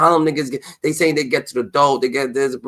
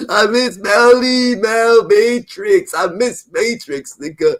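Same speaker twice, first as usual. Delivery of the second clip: high, slow, drawn-out whimper; mock whiny crying; 7 semitones higher, 5 dB louder, much slower than usual. Mocking [name] missing [name], high whining. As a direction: whine it like a crybaby. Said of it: "You should".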